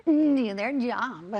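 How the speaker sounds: slow, drawn-out mock echo; mocking imitation. Commentator parrots the teacher's rant after the clip, mocking. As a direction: mimic the words with drawling derision.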